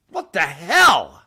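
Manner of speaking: slow, loud, stretched-out outrage; drawn-out what the hell. Real, not acted. Ranting at Texans, a slow loud disbelieving groan. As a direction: drag out every word in disgust. Rant against the governor.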